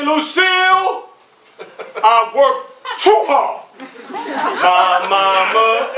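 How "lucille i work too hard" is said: high exasperated mother's call; a fed-up mom calling out a name; mother squaring up to another mother on the playground; mother confronting. Acted. Kevin's mother confronting the narrator's mother.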